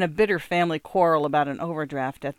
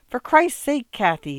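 high, louder burst of annoyance; exasperated complaint; 8 semitones higher, 6 dB louder than usual. A narrator's reading. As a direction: scold her in exasperation. Husband snaps at piano-playing wife.

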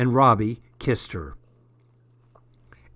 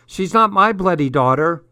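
louder, higher-pitched protest; indignant retort; 5 semitones higher, 6 dB louder than usual. A narrator's reading. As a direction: throw the blame back, pitched up. Daughter snaps back at dad.

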